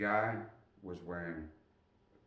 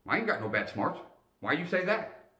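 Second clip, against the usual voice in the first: loud, high indignant yelp; indignant protest; 10 semitones higher, 7 dB louder than usual. Retold or imitated. Private objecting to the sergeant's remark, loud and high. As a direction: protest loudly like an offended kid. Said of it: "private protesting sergeant".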